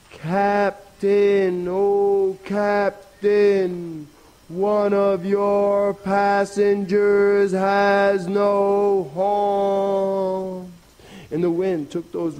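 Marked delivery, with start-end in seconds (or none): 0.1-10.7 high, very long-drawn hail; mighty calling voice. Acted. Rabbit's last call from the hilltop, mighty voice. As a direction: send each sustained word far across water. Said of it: "called out in a mighty voice".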